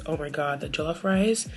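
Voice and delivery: ordinary talk, nothing marked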